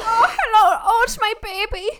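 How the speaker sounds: high, loud, hammed-up German accent; mock German accent. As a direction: coo at the baby in an exaggerated German accent. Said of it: "talk in German accents around him".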